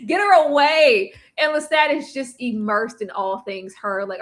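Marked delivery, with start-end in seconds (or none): 0.1-1.1 high, loud get her away; louder, higher real disgust. Real, not acted. Repeats the line louder.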